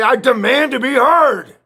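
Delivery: high, loud, slow mock declaration; mock demand. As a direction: ham up a pompous demand, drawn out. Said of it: "and say".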